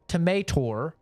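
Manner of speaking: "tomato" as tuh-MAY-tor. Shown as a demonstration. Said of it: "There's no R in fucking tomato".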